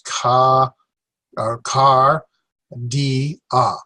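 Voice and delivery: "Ka... car, di, a" in three separate beats; syllable by syllable. Shown as a demonstration. Teacher counts three syllables for class, syllable by syllable. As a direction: break "car, di, a" apart with a pause after each. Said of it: "three syllables".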